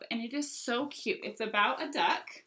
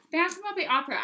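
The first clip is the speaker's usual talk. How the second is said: higher, louder delighted repeat; excited; 8 semitones higher, 7 dB louder, much faster than usual. Real, not acted. Book seller thrilled by a surprise title in the collection, excited. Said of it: "I was so excited when I saw that".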